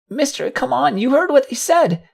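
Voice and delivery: very high, urgent colt voice; panicky, squeaky pleading; frightened child pleading. Acted. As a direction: beg a grown-up to get moving, high-pitched. Frightened colt tugs collapsed stallion.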